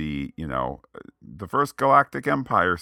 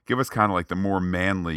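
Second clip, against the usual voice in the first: usual speaking voice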